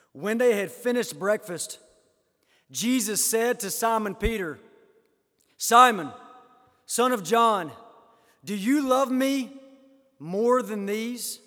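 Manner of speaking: preacher declaiming Jesus' question; emphatic scripture reading. Acted. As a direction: proclaim each phrase, with pauses between.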